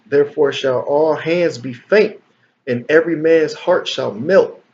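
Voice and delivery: solemn scripture reading. Acted.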